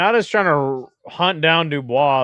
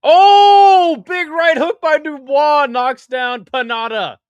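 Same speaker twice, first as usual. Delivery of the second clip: much higher and louder, slow announcer call; hyped fight call; 12 semitones higher, 10 dB louder, slower than usual. Pretend. Streamer calling the knockdown to chat, hyped announcer shout. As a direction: belt it out like a ringside commentator.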